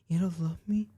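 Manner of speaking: half-imitate a partner about to cry; mock tearful. Girlfriend gaslighting him before she starts crying, mock tearful. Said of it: "she starts crying".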